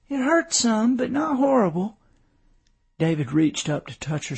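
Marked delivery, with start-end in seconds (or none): 0.1-2.0 put-on high-pitched child answering; small daughter owning up to a sore spot; little girl's voice. A narrator's reading.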